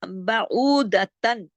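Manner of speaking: बाऊदतन with a long held ऊ; measured teaching recitation; slow lengthened recitation. Shown as a demonstration. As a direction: recite बाऊदतन slowly, stretching the oo. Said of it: "आवाज को खींचने का काम कर रहा है".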